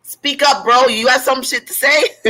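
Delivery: high, mocking taunt to speak up; God daring Job to talk, in a high cocky taunt. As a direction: goad Job like a cocky bro, pitched high and laughing. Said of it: "he's pretty much saying".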